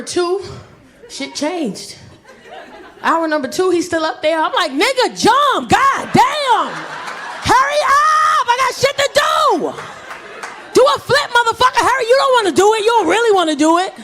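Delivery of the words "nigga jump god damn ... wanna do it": put-on high, loud yell at a dawdling kid; full-voiced, high-pitched comic outburst; exasperated shouting. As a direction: holler impatiently for them to hurry up. Yelling at a child to jump.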